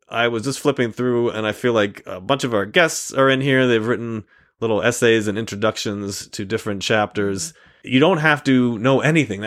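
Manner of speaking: usual speaking voice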